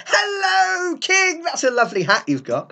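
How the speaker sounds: high, sing-song fake politeness; tactless courtier. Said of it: "and go".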